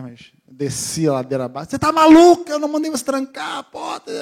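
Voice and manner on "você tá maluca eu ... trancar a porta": high, loud furious scolding; angry yelling. Retold or imitated. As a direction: tear into the daughter, shrieking. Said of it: "foi feio demais". (bronca furiosa, aguda e alta)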